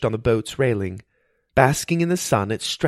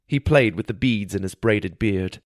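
plain reading voice

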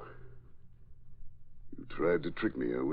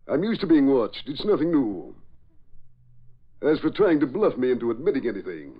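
defiant retort; 6 semitones higher, 5 dB louder than usual. Acted. Patton defiant against accusations.

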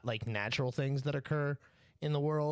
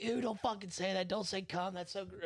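higher, softening grossed-out protest; mock disgust; 6 semitones higher than usual. Coworker begging them not to say it, mock disgust. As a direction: imitate someone squirming at a word. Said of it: "people get disgusted by certain words".

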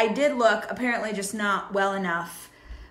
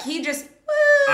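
falling woo; 5 dB louder, slower than usual. Retold or imitated. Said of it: "that's what he looked like".

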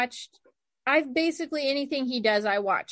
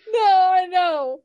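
laughing; 7 semitones higher, 8 dB louder, slower than usual. Real, not acted. Still laughing.

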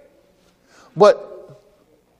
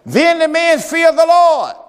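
preaching shout; 7 semitones higher, 4 dB louder, slower than usual. Acted. Preaching climax.

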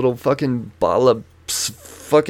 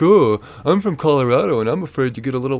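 higher-pitched mocking bravado; mock super-cool; 4 semitones higher, 4 dB louder than usual. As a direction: imitate his scoffing, too-cool act. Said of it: "he's trying to be super cool about it".

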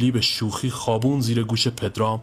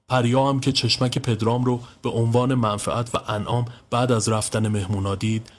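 usual speaking voice

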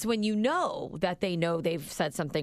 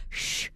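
drawn-out "shh" sound; mimicked unfinished swear; imitated cut-off word. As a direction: give only the hushing first sound. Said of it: "she just went".

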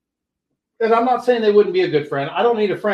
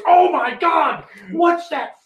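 disgusted shout; 8 semitones higher, slower than usual. Retold or imitated. Mom shouting from inside at the stinking dad. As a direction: recoil from the stench and shout it out. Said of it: "And she goes".

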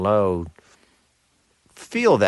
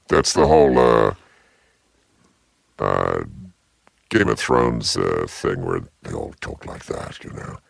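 very deep voice. Pretend. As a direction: drop into a deep lordly register. Mock deep English voice.

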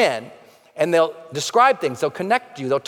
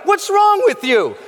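higher, louder, slower: an incredulous accusing yell; mock accusing shout; 13 semitones higher, 5 dB louder, slower than usual. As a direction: throw it at him like he's lost his mind. Old friends question his change.

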